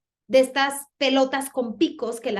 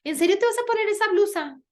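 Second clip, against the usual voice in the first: higher, louder mocking disbelief; nosy mother-in-law; 8 semitones higher, 4 dB louder than usual. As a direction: needle her about the blouse. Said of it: "Que la suegra llegue y te diga". (suegra burlona e incrédula)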